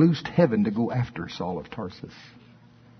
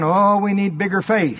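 mock whiny plea; 7 semitones higher, 3 dB louder than usual. Disciples whining for more faith.

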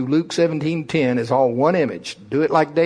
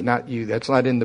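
ordinary talk

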